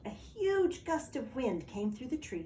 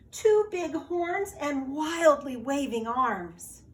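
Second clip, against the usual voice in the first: louder, slower, higher build; suspense building; 5 semitones higher, 6 dB louder, slower than usual. A narrator's reading. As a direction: pile on the scary details. Narrator builds monster description.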